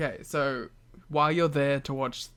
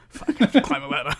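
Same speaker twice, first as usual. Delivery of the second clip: fast, laughing fake-grave Dusty voice; mock serious Dusty; 5 dB louder, faster than usual. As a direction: pretend dread of the ladder. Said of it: "Dusty gets very serious".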